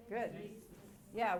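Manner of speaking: ordinary delivery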